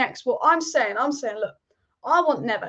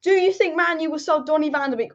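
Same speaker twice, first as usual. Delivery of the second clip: ordinary talk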